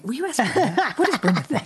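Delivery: high, teasing imitation of a friend's plea; mock pleading. Mocking friends who always ask for Brenda, high and teasing. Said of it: "That's my favorite thing".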